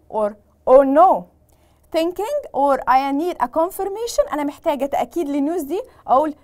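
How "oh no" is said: loud dismayed oh no. Shown as a demonstration. Teacher demonstrates reply to bad news.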